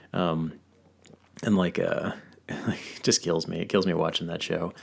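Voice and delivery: ordinary talk, nothing marked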